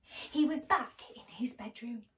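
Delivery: ordinary delivery, nothing marked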